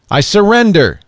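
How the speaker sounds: high, loud surrender call; man with laser dots on him calling out, loud surrender; urgent call. A narrator's reading. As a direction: call it out to the gunmen. Lee Daniel calls out, guns on him.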